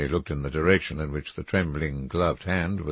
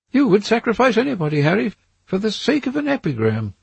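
put-on higher, anxious young gentleman's voice; a nervous young man seeing a threat in the trees; frightened Dorian; 6 dB louder than usual. A narrator's reading. Dorian to Lord Henry, pointing at the trees, higher and anxious.

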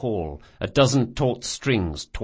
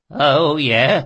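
louder, shaky and scared; quavering voice; 6 semitones higher, 7 dB louder than usual. A narrator's reading. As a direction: let the voice quaver with fear. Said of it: "his voice quavering".

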